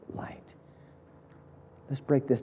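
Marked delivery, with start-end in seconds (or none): 0.1-0.4 slow, whispered promise. Acted. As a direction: breathe the last words out gently, slowly. Tender close of Jesus' offer.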